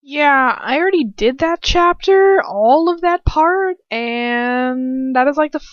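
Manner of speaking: exasperated loud. Real, not acted. Reader annoyed at reading the chapter again, loud and high. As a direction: blurt it out exasperated.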